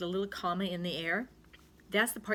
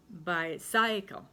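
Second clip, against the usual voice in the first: "bicycle" as bye-SIGH-kul; stress on middle syllable; much slower than usual. Shown as a demonstration. Teacher trying another stress, "bicycle" stressed on a long middle "cy". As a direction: hit the "cy" of "bicycle", rhyming with sigh. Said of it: "pronounce it with the C Y".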